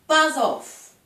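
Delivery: exasperated outburst; loud dismissal. Acted. Nymph repels her suitor again.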